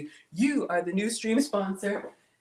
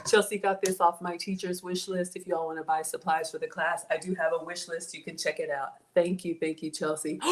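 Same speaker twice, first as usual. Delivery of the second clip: ordinary talk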